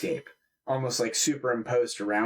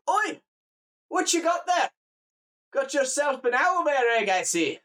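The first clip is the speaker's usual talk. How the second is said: high-pitched, nosy Scottish countryman; Scottish farmer voice pitched well up; 11 semitones higher than usual. Acted. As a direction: call out as a surprised Scottish farmer. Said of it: "like a Scottish person or whatever".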